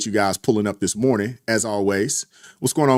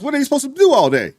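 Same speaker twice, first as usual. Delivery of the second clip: much higher, sing-song mock outrage; mock whiny complaint; 4 dB louder than usual. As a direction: whine it like the lazy folks he mocks. Mocking people who lack jobs.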